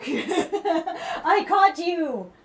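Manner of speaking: giggling through the words; laughing. Real, not acted. Laughing as she catches her cat sneaking. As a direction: laugh it out, delighted to catch him.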